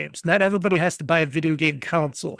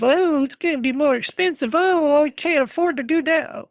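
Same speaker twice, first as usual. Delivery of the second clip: higher, mocking whiny voice; mock whining; 9 semitones higher than usual. Podcaster mocks gamers whining about console prices, in a higher mocking whine. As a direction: mimic a complainer moaning about prices. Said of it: "people just whining and crying".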